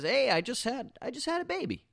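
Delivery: high-pitched mock friend with big news; excited friend. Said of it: "your friend comes up and says".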